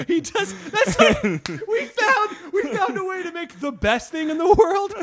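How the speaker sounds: high, loud, talking through laughter; laughing hard. Real, not acted. Host cracking up over the Nick bit, real laughter. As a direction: squeal it out while cracking up.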